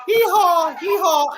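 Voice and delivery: donkey's calling reply; donkey bray. Retold or imitated. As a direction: bray back like an answering donkey. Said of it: "they'll go".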